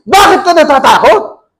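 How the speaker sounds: Jesus rebuking the scared disciples. Shown as a demonstration. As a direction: snap it as a rebuke. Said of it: "Eh, kaysa yung".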